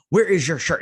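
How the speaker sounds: stern, indignant question, hammed up; scolding mom. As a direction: act out a mom catching her son. Mom catches son, acted scolding.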